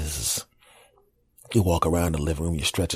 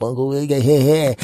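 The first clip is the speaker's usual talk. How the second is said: waking nephew; 7 semitones higher, 6 dB louder than usual. Retold or imitated. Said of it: "he'd wake me up".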